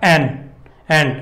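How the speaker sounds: first syllable "ਐਨ" on its own, short, twice; isolated stressed syllable. Shown as a demonstration. Teacher isolating the stressed syllable, "ਐਨ" said alone. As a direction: pick out "ਐਨ" by itself, pause, repeat it. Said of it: "ਉਹਦੇ 'ਤੇ ਜ਼ਿਆਦਾ ਜ਼ੋਰ ਪੈ ਰਿਹਾ".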